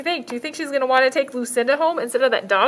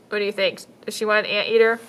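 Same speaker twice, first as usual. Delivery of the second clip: usual speaking voice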